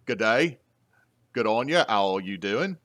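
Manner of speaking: chirpy mock-Aussie elf, lilting upward; Australian accent. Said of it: "All my elves are Australian".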